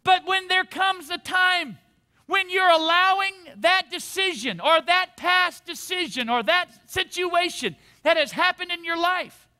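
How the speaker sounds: ordinary talk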